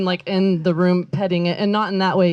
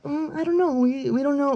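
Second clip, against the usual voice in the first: shy cats voice; 6 semitones higher, 4 dB quieter than usual. Retold or imitated. Voicing her shy cats.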